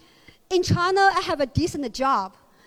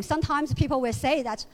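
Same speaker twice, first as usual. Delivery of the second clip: normal talking voice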